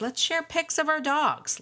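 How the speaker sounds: rally the listeners with enthusiasm; excited. Real, not acted. Excited invitation to share pics.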